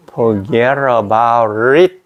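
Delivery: "Forget about it" very slowly as "forgerobarit"; slow drill "Forget about it"; slow practice take. Shown as a demonstration. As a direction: drill it slowly, linking every word. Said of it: "연습하기 이게 제일 좋아요".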